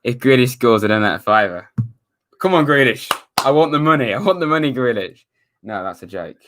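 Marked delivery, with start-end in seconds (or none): 2.4-5.2 mock greedy cheering, higher and louder; sarcastic. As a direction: cheer for the payout, tongue in cheek. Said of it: "That's sarcasm".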